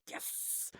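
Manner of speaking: imitating a kid's hissed, whispered yes; whispered triumph. Son wins the Die Hard deal, whispered triumphant yes. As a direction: whisper it as a private little victory.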